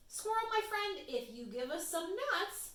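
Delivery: Little Bird voice. Acted. Bird offers trade.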